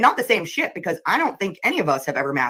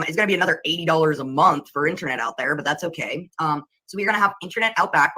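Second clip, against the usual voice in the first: ordinary talk, nothing marked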